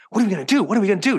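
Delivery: mock-panicked, high-pitched flailing question; freaking out. Quarterback to the coach after shock touchdown, imitated freaking out. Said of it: "Just freaking out".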